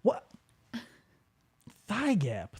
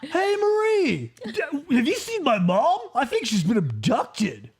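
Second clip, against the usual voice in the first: mock Brian; 4 semitones higher than usual. Imitating Brian greeting Marie.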